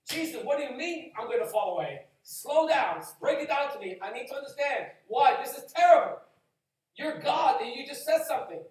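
loud, high, pressing Jesus to explain; urgent pleading disciple. Retold or imitated. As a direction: demand answers, alarmed. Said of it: "they should be asking".

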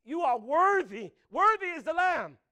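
loud, high cry of worship; Worthy slowed and shouted. Retold or imitated. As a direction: lift the praise up to God. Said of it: "He's like".

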